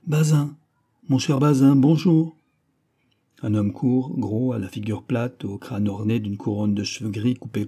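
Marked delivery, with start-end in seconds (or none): 0.1-2.4 called out loud and high across the room; calling out. A narrator's reading. Musketeer calls out to Bazin through the open window, loudly. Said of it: "et cria".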